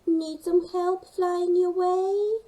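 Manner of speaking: high, slow, friendly bird voice; a little seabird offering help from above; helpful snow petrel. Acted. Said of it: "asked a snow petrel from above".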